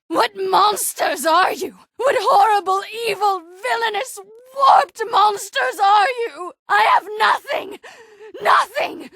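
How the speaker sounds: shattered, wailing rage; anguished crying scream. Acted. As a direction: scream her loss at them through tears. Grief-stricken rage after tree destroyed.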